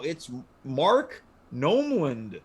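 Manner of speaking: ordinary talk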